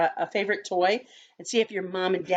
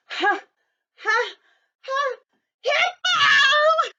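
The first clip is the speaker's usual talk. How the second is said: rising ha ha ha, then a loud high shout; laughing out a name; 6 dB louder, much slower than usual. Acted. Rhino laughs and finally shouts his name, much higher.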